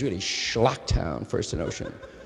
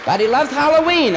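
high, loud Yiddish mother; Yiddish accent; 6 semitones higher, 4 dB louder, faster than usual. Retold or imitated. Mother voiced in story.

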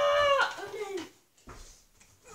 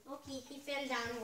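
ordinary talk

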